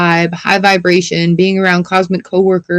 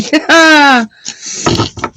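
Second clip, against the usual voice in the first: burst of laughter; 8 semitones higher than usual. Real, not acted. Laughing at tarot draw.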